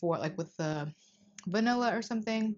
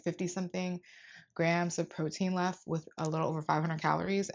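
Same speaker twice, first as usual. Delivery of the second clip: normal talking voice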